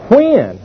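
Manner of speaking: "When" alone, pitched high then falling; stress on WHEN. Shown as a demonstration. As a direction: set WHEN apart as the key word. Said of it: "mark the word when".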